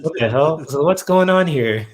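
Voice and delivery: loud, high-pitched disbelief; animated replay of a startled reaction; retold bafflement. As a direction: exclaim it as if utterly baffled. Retelling baffled reaction to visions.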